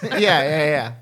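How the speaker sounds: usual speaking voice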